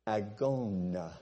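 agona said slowly, stress on the first syllable, AG-o-na; pronounced agona. Shown as a demonstration. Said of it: "so we would pronounce it".

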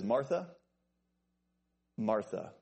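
"Martha" lifted, long pause, then "Martha" settling down; name said twice to get attention. Acted. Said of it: "that second time, it's such a call to attention".

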